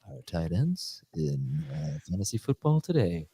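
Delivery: mock Masters broadcast, murmured low and unhurried; hushed golf commentary. Host parodying a Masters promo on a football show, hushed golf commentary. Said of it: "wondering why I'm talking like this".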